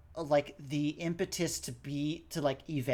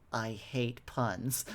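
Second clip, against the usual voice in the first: slow, softer and lower deadpan; serious and quiet; 4 semitones lower, 6 dB quieter, slower than usual. Retold or imitated. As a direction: say it gravely, almost under your breath. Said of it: "really, serious and quiet".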